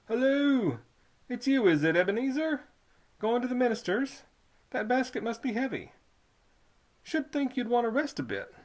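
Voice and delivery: put-on high, loud old toll keeper; sly old toll keeper coaxing the boy; Squids' voice. Old toll keeper luring a boy in, high and loud.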